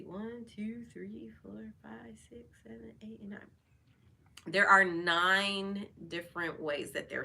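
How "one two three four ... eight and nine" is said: whispered counting to herself; soft whispered tally; counting under breath. Real, not acted. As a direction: murmur the numbers off quietly.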